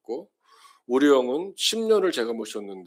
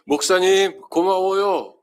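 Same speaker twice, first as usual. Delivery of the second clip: much higher and louder, 고마워요 falling at the end; called-out thanks; 8 semitones higher, 10 dB louder, slower than usual. Shown as a demonstration. Pastor acting a member calling thanks to him, loud and high. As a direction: shout it up to the pulpit with a falling close. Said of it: "이렇게".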